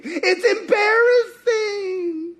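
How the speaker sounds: high, drawn-out tearful whine; mock whining. Elijah dreading going back, mock tearful whine. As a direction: wail it as a hammed-up sob.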